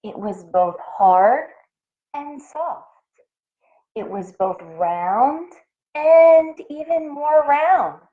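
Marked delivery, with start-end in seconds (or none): 5.9-8.0 higher, slow and louder; storyteller building excitement; building wonder. A narrator's reading. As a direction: stretch it out with rising wonder. Building suspense about the shape.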